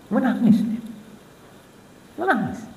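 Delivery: high, soft, tearful telling of the weeping. Acted. Preacher tells of Zaid crying, high and tearful. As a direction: let the voice rise and thin as if near tears. Zaid weeps at being disbelieved.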